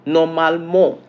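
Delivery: "normalement" said the French way, ending in "mo"; French pronunciation. Shown as a demonstration. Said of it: "in French".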